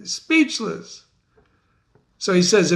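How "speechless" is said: higher, pressing demand; challenging Banquo. Acted. Teacher voicing Banquo challenging the witches, higher. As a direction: confront the witches for his partner. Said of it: "So Banquo challenges the witches".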